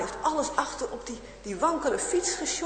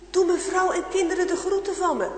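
calling out; 6 semitones higher, 5 dB louder than usual. Acted. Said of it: "Hij kan nog net naar de boer roepen".

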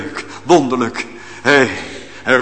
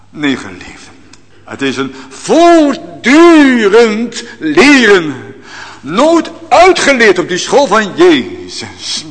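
fervent preaching; 7 semitones higher, 4 dB louder than usual. Acted. Preacher urging beloved hearers, loud fervent exhortation. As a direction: preach it out with rising fervour.

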